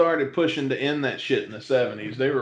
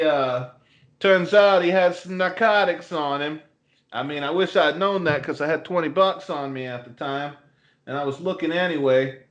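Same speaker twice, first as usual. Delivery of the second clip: lightly put-on Boston accent, raised a little; a hint of Boston tough guy telling a story; 4 semitones higher than usual. Teasing cohost with a Boston accent story. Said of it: "once you get into that Boston accent".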